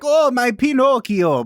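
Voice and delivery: high, slow, mock Swedish accent as an old woodcarver; hammed-up Swedish accent, drawn out high; Swedish Geppetto. Podcast host mocks an actor's accent as Swedish Geppetto. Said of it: "a voice that I would describe as Swedish Geppetto".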